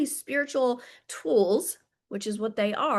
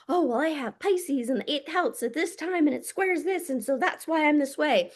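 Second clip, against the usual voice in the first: lightly higher-voiced imitation of an over-explainer; mock astrology devotee; 4 semitones higher than usual. Speaker mimicking people fixated on astrological charts, mock higher voice. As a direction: mimic someone rattling off chart excuses.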